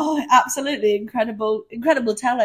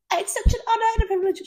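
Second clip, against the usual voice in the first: much higher, fast, breathless gush; mock gushing; 11 semitones higher, faster than usual. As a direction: gush it in a high rush. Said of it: "She's like".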